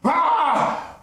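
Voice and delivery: loud, high ghost wail; ghostly wail. Acted. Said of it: "rattling his chains and wailing".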